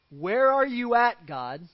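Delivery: a pleading demand to God; loud plaintive cry. Retold or imitated. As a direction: call out high and loud, then drop. Gideon's complaint voiced loudly.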